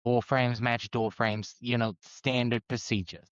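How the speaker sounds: overdone fake New York accent; bad New York accent. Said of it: "it is bad New York accent".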